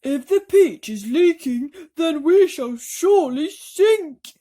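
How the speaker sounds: very loud, high panicked wail; doom in every word; panicking earthworm. A narrator's reading. Earthworm wailing to all that they will sink.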